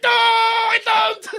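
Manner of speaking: very high, drawn-out mock yell; panicked denial. Lancer denies commanding a U-boat, mock panicked shout. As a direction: overreact like an exposed spy.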